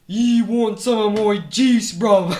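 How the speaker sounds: very loud, high mock British offer; Kool-Aid Man. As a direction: shout it as a pushy British mascot. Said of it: "The Kool-Aid Man's, like, terrifying".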